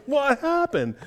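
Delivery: startled high question; wife seeing him after the shower, alarmed. Retold or imitated. Wife alarmed at his state, startled cry. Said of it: "She goes".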